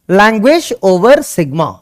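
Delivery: very slow, spaced words; slow dictation. Shown as a demonstration. Teacher to students, dictating the term slowly. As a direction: say it slowly for notes.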